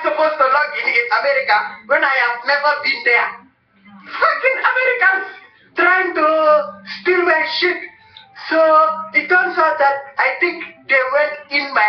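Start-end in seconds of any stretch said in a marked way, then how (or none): 4.1-7.8 furious high-pitched shout at the hackers; angry yell. Real, not acted. Vlogger cursing the Americans who hacked him, in a cracking furious yell. Ranting about hackers stealing account.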